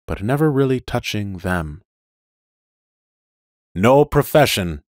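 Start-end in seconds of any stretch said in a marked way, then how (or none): 3.8-4.8 hissing phonograph voice; robot voice. Acted. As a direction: hiss it like a machine. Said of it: "said the phonograph voice, hissing".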